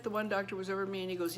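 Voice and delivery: ordinary delivery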